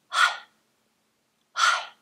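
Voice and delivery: short, voiceless whispered pushes of HI. Shown as a demonstration. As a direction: whisper "hi" in separate puffs of air. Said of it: "We're just gonna whisper".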